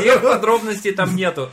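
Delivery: loud, high, through laughter; real laughing. Real, not acted. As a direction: crack up while getting the words out. Cracks up while admitting omissions.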